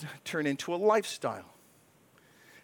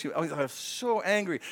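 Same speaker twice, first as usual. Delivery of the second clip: ordinary talk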